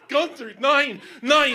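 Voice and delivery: loud, high-pitched dog orders; shouted commands. Retold or imitated. Handler pulling Gunther off a traveler, shouting. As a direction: bellow orders to call a dog off.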